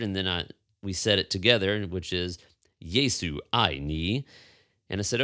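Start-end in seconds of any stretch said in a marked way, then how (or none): 2.8-4.3 Yesu ai ni said slowly, syllable by syllable, in Mandarin; slow Mandarin phrase. Shown as a demonstration. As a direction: sound out Yesu ai ni carefully like a lesson. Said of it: "how to say each word".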